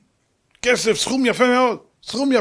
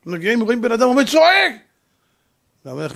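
usual speaking voice

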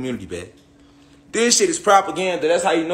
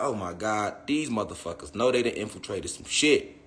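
muttered aside; 7 semitones lower, 10 dB quieter than usual. Retold or imitated. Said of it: "I be like".

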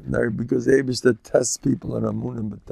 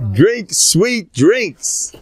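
each word stretched very slow, higher and louder; drawn-out decree; 7 semitones higher, 4 dB louder, much slower than usual. Acted. Rebbe decrees against the young, slow proclaimed order. Pronounces his verdict on drinks.

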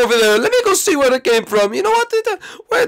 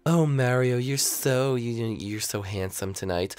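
flirty Rosalina; 12 semitones lower, 9 dB quieter, slower than usual. Acted. Rosalina voiced, flirting with Mario.

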